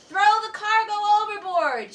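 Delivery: high, loud urgent command; shouted order; captain's shout. Acted. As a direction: bellow orders over the storm. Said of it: "shouted the captain".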